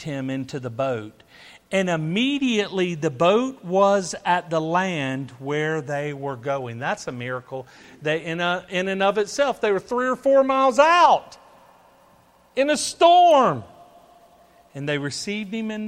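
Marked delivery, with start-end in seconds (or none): 1.7-6.8 louder opening, settling by the end; emphatic scripture reading. Real, not acted. Preacher reads boat miracle to congregation, reading emphatically. 8.0-13.6 building to a high, loud shout; preaching, building. Acted. Preacher marvels at miracle in the storm, preaching ever louder.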